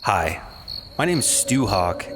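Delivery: usual speaking voice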